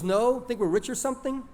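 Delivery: high, scornful comeback; mildly indignant, pitch jumping up; indignant retort. A narrator's reading. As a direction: throw it back as a sarcastic question. Acted retort to friend's suggestion.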